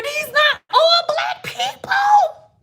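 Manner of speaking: high, slow, half-crying exasperation; laughing-crying exasperation; exasperated squeal. Real, not acted. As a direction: squeal it in disbelief. Exasperated, viewers are watching.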